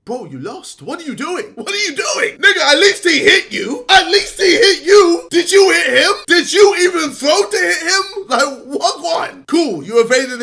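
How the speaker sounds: loud, high exasperated yelling; angry shouting. Real, not acted. [name] yelling at [name] for losing the fight. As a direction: shout at him in disbelief. Angry rant at [name]'s boxing loss.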